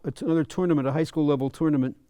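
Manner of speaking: usual speaking voice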